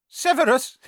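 high, loud biting exclamation; sharp exclamation. A narrator's reading. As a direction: spit the name out loud. Quirrell exclaims name.

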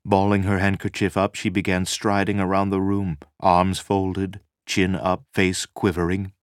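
usual speaking voice

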